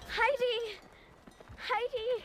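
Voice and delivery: high, loud call across distance. Acted. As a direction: shout her name from afar. Calling to friend from afar.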